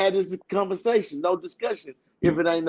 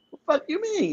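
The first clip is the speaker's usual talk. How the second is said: much higher, softer imitated snap; mock squeaky retort; 11 semitones higher, 4 dB quieter, faster than usual. Man imitating his own cussing at his partner, high mock snap. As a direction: pitch the cuss way up, half-joking. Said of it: "I'm like".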